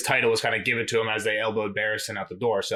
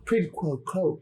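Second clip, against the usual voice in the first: put-on Anthony Hopkins accent; 4 semitones higher, much slower than usual. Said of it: "you gotta do the Anthony Hopkins ac-, accent".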